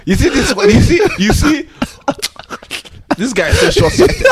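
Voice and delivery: high, loud laughing protest; laughing at the cheek; laughing disbelief. Real, not acted. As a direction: call him out through laughter.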